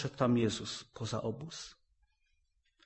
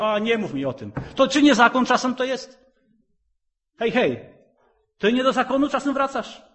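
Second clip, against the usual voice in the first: mock scoffing believer; 12 semitones higher, 10 dB louder than usual. Mocking reluctant believer.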